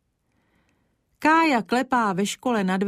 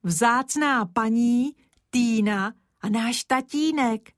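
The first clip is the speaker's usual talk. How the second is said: slow, drawn-out "vzácná paní" with rising tune; awed child stretching out "vzácná paní"; slow exclaimed; 3 semitones higher, slower than usual. A narrator's reading. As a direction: savour "vzácná" and let it rise. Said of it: "zvolal Kája".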